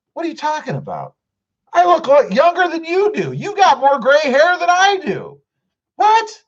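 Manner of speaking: high, fast indignant protest; mock outrage. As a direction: play offended at the dig, pitch way up. Mock outrage at aging joke.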